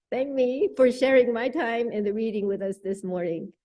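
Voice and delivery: ordinary delivery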